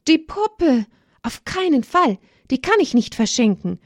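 put-on high, indignant child's refusal; girl refusing to give up her doll; defiant girl. A narrator's reading. Olivia refuses in her thoughts.

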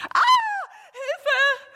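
schriller, viel höherer Hilfeschrei; erschrockener Schrei. A narrator's reading. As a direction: vor Schreck laut aufschreien. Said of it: "schreit sie erschrocken". (Mama schreit im dunklen Kinderzimmer vor Schreck)

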